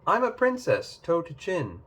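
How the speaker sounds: high, haughty princess boast; proud princess. Acted. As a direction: preen like a little royal.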